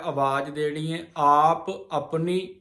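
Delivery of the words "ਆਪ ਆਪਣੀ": ਆਪ ਆਪਣੀ said slowly, then a pause after ਆਪਣੀ; ਆਪਣੀ ਤੋਂ ਬਾਅਦ ਵਿਸਰਾਮ. Shown as a demonstration. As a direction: stop briefly after ਆਪਣੀ as a scripture pause. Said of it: "ਇੱਥੇ ਹੀ ਵਿਸਰਾਮ ਆ".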